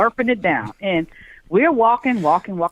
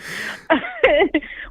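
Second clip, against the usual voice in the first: mock sob; 10 semitones higher than usual. Fake cry after apology.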